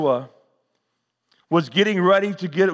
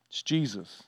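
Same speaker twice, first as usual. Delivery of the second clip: soft and low again on the name; hushed reveal repeated; 7 semitones lower, 12 dB quieter than usual. Acted. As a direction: repeat it quietly so it sinks in.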